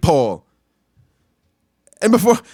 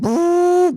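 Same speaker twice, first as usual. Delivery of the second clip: cart noise; 11 semitones higher than usual. Retold or imitated. Mimicking the cart rolling.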